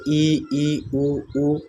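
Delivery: ई उ ऊ held with the mouth nearly closed; vowel demo. Shown as a demonstration. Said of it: "संवृत स्वर". (ई उ ऊ मुख लगभग बंद रखकर बोलना)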